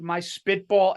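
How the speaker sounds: ordinary delivery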